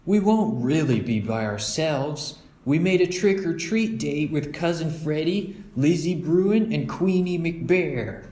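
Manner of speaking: put-on a boy reassuring his parents; Brother. Brother telling his parents about their friends, eager.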